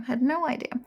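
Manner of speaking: normal talking voice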